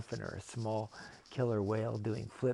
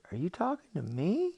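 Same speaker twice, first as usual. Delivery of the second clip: put-on high, incredulous child's question; startled little-boy voice; disbelieving boy; 8 semitones higher, 4 dB louder than usual. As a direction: shoot up in pitch on the last word. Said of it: "Jordan shakes his head".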